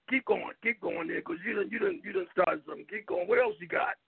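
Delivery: ordinary talk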